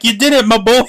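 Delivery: mock congratulation. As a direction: play Vink cheering his boy on. Mimics Vink congratulating the hero.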